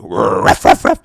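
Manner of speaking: loud, excited imitated dog barks; mock angry barking. Excited dog barks at its owner crouched low, loud mock barking. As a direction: bark like a worked-up little dog. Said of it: "your dog gets excited and angry".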